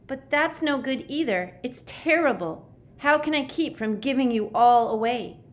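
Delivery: high, agitated worry; anxious outburst in a high voice; panicky distress. A narrator's reading. Panicking boy fears betraying his friends.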